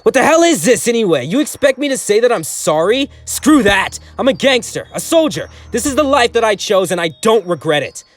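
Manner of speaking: furious gangster. A narrator's reading. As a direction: spit the words back at them. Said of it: "he spat back".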